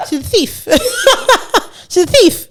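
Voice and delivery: high-pitched, laughing as it comes out; laughing accusation. Real, not acted. Podcast host calling someone a thief, laughing. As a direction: crack up while accusing.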